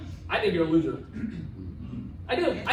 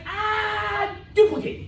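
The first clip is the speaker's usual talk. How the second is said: hammed-up quote of a speaker; imitating a speaker; 8 semitones higher, much slower than usual. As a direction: mimic him calling out, pitched up. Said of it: "That's what he said".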